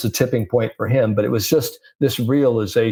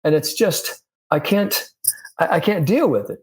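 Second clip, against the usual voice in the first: higher-pitched imitation of a baffled, frustrated golfer; exasperated retelling; 8 semitones higher than usual. Golfer venting to his coach about stray shots, mock exasperation. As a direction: act out mild helpless exasperation.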